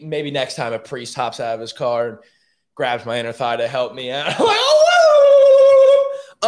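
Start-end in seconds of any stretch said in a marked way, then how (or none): 4.6-6.5 long, loud, very high "woo"; high comic whooping cry; joking whoop. Pretend. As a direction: let out a drawn-out mock squeal. Joking whoop about imagined priest grope.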